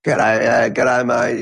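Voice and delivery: broad Aussie greeting, lightly mocked; mock an old Aussie bloke saying g'day. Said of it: "a lot of blokes talk like this".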